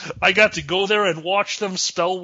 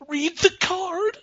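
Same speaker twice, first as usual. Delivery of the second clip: high, slow, strained put-on voice; put-on delivery kid; 14 semitones higher, slower than usual. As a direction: play the suffering delivery boy, drawn out. Acts out the delivery kid's line.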